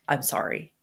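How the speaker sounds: low, soft and sincere; a quiet heartfelt apology. Shown as a demonstration. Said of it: "in a heartfelt way, say".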